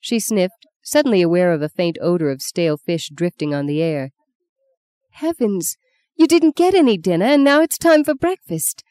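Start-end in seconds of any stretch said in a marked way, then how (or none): 5.1-8.8 high, rising surprised exclamation; startled she forgot his dinner, voice jumping high; sudden dismay. Acted. Justine suddenly realises he missed dinner.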